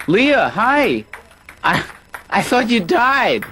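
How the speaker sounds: high, loud and startled, then slow; surprised greeting. Acted. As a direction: greet an old friend with shocked delight. Spots an old rival.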